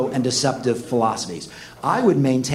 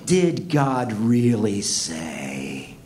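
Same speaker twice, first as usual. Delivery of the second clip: low, very slow, sly serpent voice; 5 semitones lower, much slower than usual. Acted. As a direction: drag out the tempting question like a snake. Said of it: "He comes slithering up to her".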